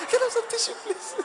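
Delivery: high fake-crying voice; theatrical weepy request; mock tearful plea. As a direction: beg squeakily through pretend tears. Acted weeping wife repeats plea.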